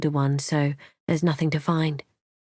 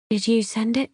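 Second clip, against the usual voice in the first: higher, tentative question; weak, tentative; 5 semitones higher than usual. A narrator's reading. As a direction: venture it timidly, pitch lifted. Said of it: "I venture weakly".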